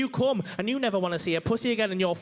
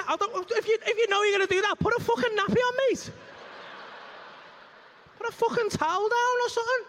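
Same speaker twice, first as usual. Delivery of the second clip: exasperated rant; 9 semitones higher than usual. Real, not acted. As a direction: rant high at her. Ranting at squirter.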